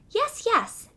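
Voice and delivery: high little mouse voice, eager; a timid mouse agreeing brightly. Acted. Mouse agreeing to cat's request, high mouse voice.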